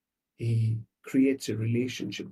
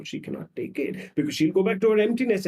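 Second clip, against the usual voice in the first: usual speaking voice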